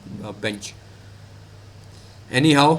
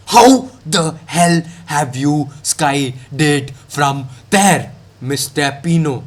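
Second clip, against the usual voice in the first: a very slow, drawn-out "How the hell have you skied it from there"; 1 drawn-out repeat; 3 semitones lower, much slower than usual. Shown as a demonstration. To Pino after his miss, slow, exaggerated disbelief. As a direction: stretch every word in mock disbelief.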